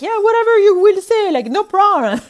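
high, loud breezy agreement; carefree patient. Retold or imitated. French patient agreeing to anything the doctor says, breezy and high. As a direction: toss it off like anything goes.

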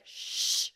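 a quick voiceless shush; breathy shushing noise; imitated shush. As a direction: hush her before anyone hears. Guy hushing loud Rachel.

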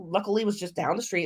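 ordinary talk, nothing marked

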